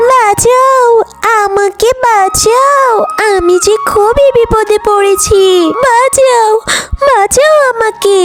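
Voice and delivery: high-pitched drawn-out plea, not truly shouted; acted cry for help. Cow calling for help in the forest, acted high cry. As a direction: call out high for rescue. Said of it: "জোরে জোরে চিৎকার করতে শুরু করে".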